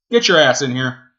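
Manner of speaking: loud gruff order; yelling at the dog. Real, not acted.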